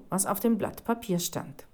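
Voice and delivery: ordinary delivery, nothing marked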